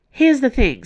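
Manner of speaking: bursting to share the news; excited. Acted. Aramis eagerly bringing the others her discovery, excited. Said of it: "bright-eyed and excited".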